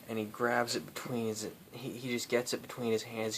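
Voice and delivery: ordinary talk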